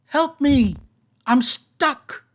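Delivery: high calling voice from down below; voice from the well. Acted. Well voice calls up to the adventurers for help. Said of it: "you hear a voice echoing up from the well".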